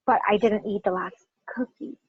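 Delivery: brief break before a prominent "cookie"; stress on COOKIE. Shown as a demonstration. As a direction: separate "cookie" from the rest and stress it. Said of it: "That's what I mean when I say".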